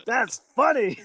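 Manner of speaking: high, mimicked boss laugh; Vince McMahon impression. Wrestler imitates the boss amused by his own gimmick, Vince McMahon impression. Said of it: "I wanna go thank Vince McMahon".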